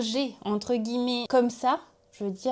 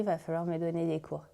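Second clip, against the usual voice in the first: ordinary talk, nothing marked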